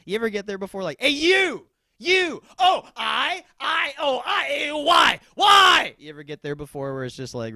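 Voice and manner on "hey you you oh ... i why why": high, loud furious vowel yells; strangled high angry shouting; yelling in vowels. Retold or imitated. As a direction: scream wordless rage at someone. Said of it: "so mad at someone you can only yell at them in vowels".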